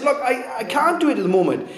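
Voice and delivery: high, hurried mock plea; acted pleading. Debtor pleading while being choked, hurried plea.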